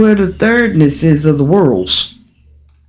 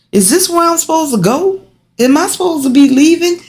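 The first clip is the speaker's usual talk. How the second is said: wondering inner voice; 10 semitones higher, faster than usual. Retold or imitated. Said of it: "and you like".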